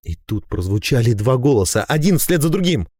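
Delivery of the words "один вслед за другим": plain reading voice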